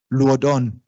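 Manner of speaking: LUODÓN as 'lwoh-don'; Creole diphthong. Shown as a demonstration. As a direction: say LUODÓN with 'u' sliding into 'o'. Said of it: "va a ser en criollo uo".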